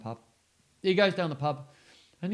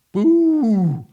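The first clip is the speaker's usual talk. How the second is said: loud high ghostly boo; 8 semitones higher, 17 dB louder than usual. Acted. Reading to kids, booing like the ghost. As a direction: spook them with a boo.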